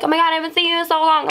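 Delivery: high, fast gush in imitation; excited greeting. Boy running after an old friend, excited.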